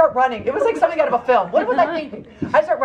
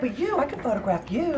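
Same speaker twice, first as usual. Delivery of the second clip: soft, quick, higher coaxing; imitated sweet talk; 5 semitones higher, 7 dB quieter, much faster than usual. John flattering the woman to get off, soft imitated sweet talk. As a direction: lay on mock sweetness. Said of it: "getting all sweet with her".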